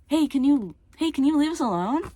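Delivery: high pleading squeal up into a crack. Real, not acted. Telling off a bully to leave us alone, voice cracking. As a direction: push "hey" way up till the voice breaks. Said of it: "don't mind the voice crack".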